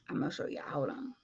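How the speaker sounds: soft unvoiced whisper. Real, not acted. Setting up the video for viewers in a whisper. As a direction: whisper it while busy.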